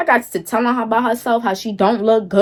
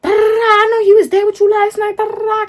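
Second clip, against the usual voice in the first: mocking rival; 11 semitones higher, 3 dB louder than usual. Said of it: "she like".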